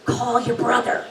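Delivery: calm dad message. Retold or imitated. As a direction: pass on dad's calm nudge. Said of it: "And he says".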